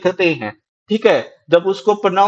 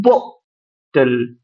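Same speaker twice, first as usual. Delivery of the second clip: "Bottle" very slow, stressed बॉ, pause, lower unstressed टल; 7 semitones lower, much slower than usual. Shown as a demonstration. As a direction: hit बॉ in "bottle", then let टल drop. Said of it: "टल में हमें ज्यादा, अ, force लगाने की जरूरत नहीं". ("बॉटल" में बॉ पर ज़ोर, टल हल्का)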